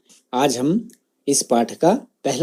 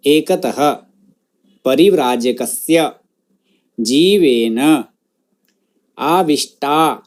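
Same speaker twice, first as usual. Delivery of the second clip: word-by-word model. Shown as a demonstration. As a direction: pronounce each word separately for students to echo. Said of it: "जिस शब्द का उच्चारण मैं करूँगा".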